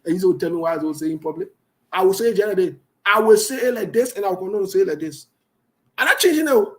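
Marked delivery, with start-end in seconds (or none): 6.0-6.7 loud, high, firm refusal; defiant. Real, not acted. Host defying callers on air, loud and firm. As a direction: plant your feet and declare it.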